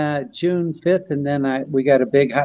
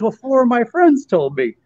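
higher, lightly voiced Rodney; a hint of Rodney, pitched up; Rodney Dangerfield voice; 8 semitones higher than usual. Retelling Rodney's gag to friends, lightly imitated. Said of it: "He goes".

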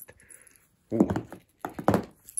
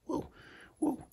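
ordinary talk, nothing marked

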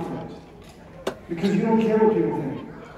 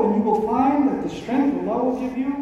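ordinary talk

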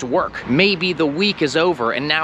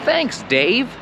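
cheery thanks; 8 semitones higher, slower than usual. Shown as a demonstration. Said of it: "you would say".

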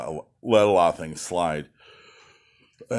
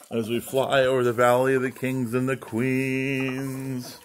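slow, higher, grand documentary voice; mock tour narration; 4 semitones higher than usual. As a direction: narrate like a solemn travel guide, slowly. Mock tour-guide narration over coop.

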